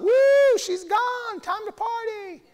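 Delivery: high, gleeful, cheering voice; mock party whoop. Divorced husband celebrating his freedom, high and whooping. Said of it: "That's not".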